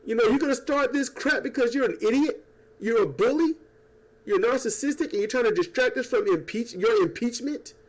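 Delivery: high, mock-outraged rant; imitating angry people. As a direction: fire it off like fed-up protesters. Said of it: "Because people are like".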